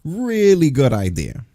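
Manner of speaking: slow, dripping sarcasm; drawn-out mocking praise; sarcastic. Sarcastic praise of WWE idea.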